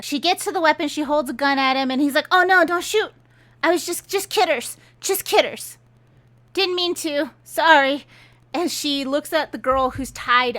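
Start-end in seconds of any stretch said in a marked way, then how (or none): 2.3-8.1 high-pitched, hammed-up backpedaling; mock pleading. Drifter with a gun held at him, mock pleading. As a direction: imitate a coward begging off in a higher voice. Mocked villain pleads at gunpoint.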